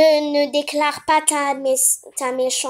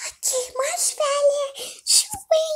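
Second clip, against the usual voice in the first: Pinkie Pie doll voice; 9 semitones higher than usual. Pretend. Pony doll feeding the baby, high pony voice. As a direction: play the pony doll, pitched way up.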